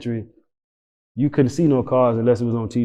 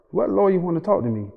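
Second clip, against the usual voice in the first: confused; 5 semitones higher, 3 dB quieter, faster than usual. Retold or imitated. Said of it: "I'm lost".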